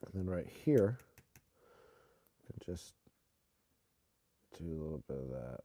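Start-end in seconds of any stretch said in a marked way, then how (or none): none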